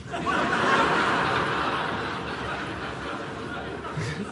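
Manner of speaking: quiet airy chuckle; breathy laugh. Real, not acted. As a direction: laugh softly under breath. Laughs at own joke.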